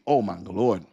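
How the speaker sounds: normal talking voice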